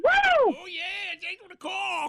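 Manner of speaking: hammed-up prospector shout; grizzled prospector. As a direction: holler like a wild old miner. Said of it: "impression of the old grizzled prospector".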